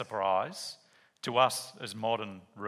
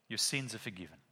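gentle quiet pronouncement; 4 semitones lower, 7 dB quieter than usual. Acted. Jesus speaks to paralysed man.

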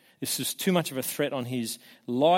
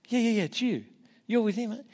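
higher-pitched, quick-fire recognition; accusing servant girl; 8 semitones higher, faster than usual. Retold or imitated. Servant girl challenging Peter by the fire, accusing. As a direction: imitate a girl pointing someone out.

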